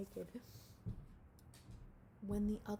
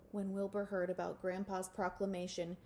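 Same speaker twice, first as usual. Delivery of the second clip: usual speaking voice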